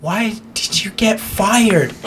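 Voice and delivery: high, slow, upset wife voice; a wife asking in dismay; giraffe's wife. Acted. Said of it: "I'll do the wife first".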